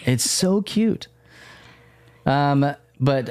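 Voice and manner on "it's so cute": gushing. Real, not acted. Gushing over child's cuteness.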